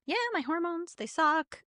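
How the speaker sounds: high, soft, offhand shrug; dismissive. Retold or imitated. New mom shrugging off her hormones, dismissive.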